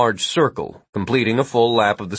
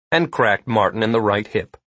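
ordinary delivery, nothing marked

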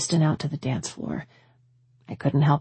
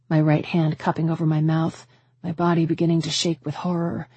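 ordinary delivery

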